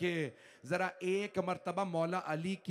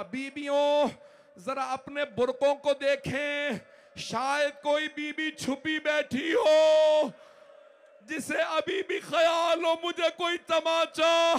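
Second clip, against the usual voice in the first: high, loud, slow lamenting shout; grieving outcry; 9 semitones higher, 8 dB louder, slower than usual. Real, not acted. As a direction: cry out to the mourners at the peak. Preacher's climax calling to women. (मजलिस के चरम पर औरतों को ऊँची, धीमी चीख़ती पुकार)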